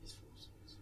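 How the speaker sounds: whispered. Real, not acted. Whispered aside to friend.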